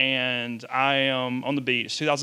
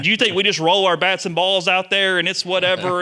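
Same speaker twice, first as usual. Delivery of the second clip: louder, higher mock coach rant; hammed-up angry coach tearing into a reporter; retold angry rant; 6 semitones higher, 7 dB louder than usual. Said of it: "And he's pissed".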